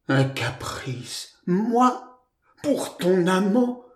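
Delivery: slow, plaintive wail; drawn-out, high-pitched lament; moaning. A narrator's reading. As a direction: moan in wounded disbelief. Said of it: "gémissait Georgette".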